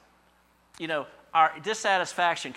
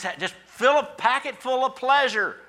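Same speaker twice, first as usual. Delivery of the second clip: mocking; 12 semitones higher, 9 dB louder than usual. Mocking pleasure seeker.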